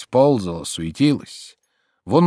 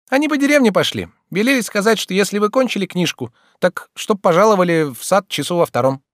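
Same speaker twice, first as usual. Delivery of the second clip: put-on very high girlish voice; messenger maid; 8 semitones higher than usual. A narrator's reading. Maid passes on the young lady's invitation, high girlish voice. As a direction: relay a message in a high maidservant's voice.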